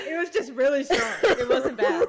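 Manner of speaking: talking through real laughter; can barely get it out for laughing; giggling while defending her friend. Real, not acted.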